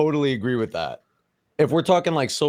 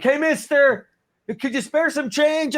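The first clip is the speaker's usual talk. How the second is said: hammed-up begging for spare change; panhandler plea; 13 semitones higher, slower than usual. Acting out begging as homeless.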